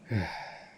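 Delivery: long, exasperated exhale; audible breathy sigh; heavy sigh. Acted. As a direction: sigh out in disapproval. Lord observing poisoned human suffering.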